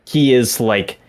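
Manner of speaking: ordinary talk, nothing marked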